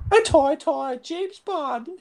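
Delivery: very high, loud cartoon bird squeal; drawn-out squeaky canary lisp; Tweety Pie. Co-host joins the cartoon bird joke about Bond, slow high squeal. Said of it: "Tweety Pie".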